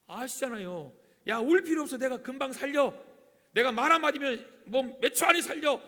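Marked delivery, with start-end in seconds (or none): 1.3-5.8 loud, high acted boast; brash assurance. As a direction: call it out, brushing away their tears. Voices Jesus dismissing the weeping. (큰소리로 장담하듯)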